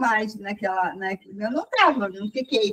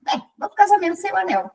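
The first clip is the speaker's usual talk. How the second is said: quick, higher-pitched confirmation; brisk decision; 6 semitones higher, faster than usual. Retold or imitated. Woman confirming her wedding decision, briskly. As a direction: say it fast, matter settled.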